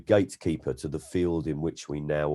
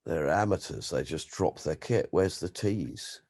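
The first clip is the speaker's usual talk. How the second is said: lightly voiced, softer world-weary connoisseur; hint of a hushed, appalled aesthete; Peter Cook impression; 3 semitones lower, 7 dB quieter than usual. A comic answering the host in a softer, weary voice. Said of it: "Peter Cook just went".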